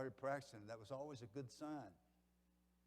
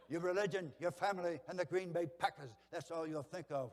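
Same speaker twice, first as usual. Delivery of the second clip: louder, higher Brooklyn coach voice; Brooklyn accent; 5 semitones higher, 7 dB louder than usual. Acted. Coach tells his players all they'll think of, loud Brooklyn accent. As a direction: impersonate a forceful coach with a thick Brooklyn accent. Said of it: "he talked like this here. He's from Brooklyn".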